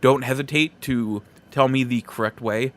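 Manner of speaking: ordinary delivery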